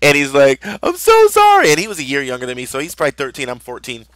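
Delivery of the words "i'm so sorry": imitating a wailing, apologizing kid; very high, loud mock sobbing; crying. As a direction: squeal it through pretend tears. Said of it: "but he starts crying".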